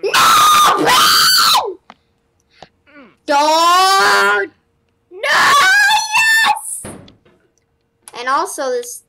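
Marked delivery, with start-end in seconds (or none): 0.0-6.8 very high, loud cries of panic; frantic yelling. Real, not acted. Gamer losing progress in the game, high panicked shouting. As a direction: scream it as everything falls apart. Game going wrong, crying out.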